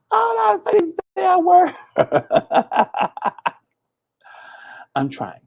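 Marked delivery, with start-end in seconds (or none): 0.1-1.7 high, loud mock scold at herself. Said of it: "I'm too country". 1.8-3.5 a high, unvoiced giggle; breathy laugh. Real, not acted. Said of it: "laughs at herself".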